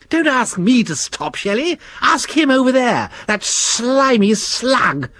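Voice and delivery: high, sulky complaining voice; E W the worm refusing to stop, high sulky voice. Acted. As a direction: point the finger, sulking. Worm sulks at Shelley.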